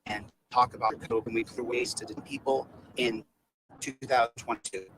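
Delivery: low, soft, halting introvert; meek, humble. Pretend. Introvert addressing a town hall, meekly. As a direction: speak humbly, low and hesitant. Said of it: "with your meek, humble voice".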